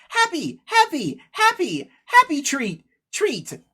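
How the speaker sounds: high, chirpy bird voice; happy bird. Acted. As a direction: chirp it happily, each word falling from a high pitch. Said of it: "and it says".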